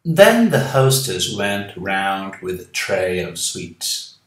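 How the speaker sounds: slow, careful English story reading. A narrator's reading. Teacher reading the English story aloud to students, slow and careful. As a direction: read each word out slowly and clearly for learners.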